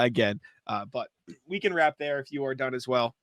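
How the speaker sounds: ordinary talk, nothing marked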